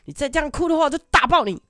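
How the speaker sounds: high, loud fist-clenched threat; mock threatening kid. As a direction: threaten like a little tough guy. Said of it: "就握拳头说".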